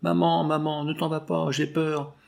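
cling to mother, begging her not to leave; frightened child. A narrator's reading. Little boy begs his mother not to go, frightened. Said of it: "en criant".

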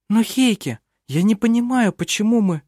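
a hint of a young woman, gently. A narrator's reading. Vinga pleading, higher.

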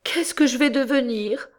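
high, weepy wail; crying wife's high voice; tearful. A narrator's reading. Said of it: "elle se mit à pleurer".

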